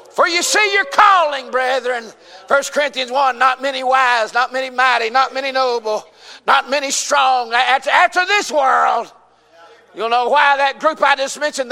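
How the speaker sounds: high, driving scripture delivery; full-force preaching. Real, not acted. Preacher quoting Corinthians to the brethren, full-force preaching. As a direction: hammer out the verse in pulpit rhythm.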